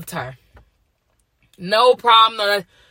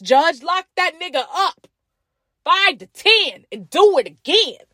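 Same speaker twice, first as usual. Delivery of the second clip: heated rant; 11 semitones higher than usual. Real, not acted. Ranting at [name] defenders.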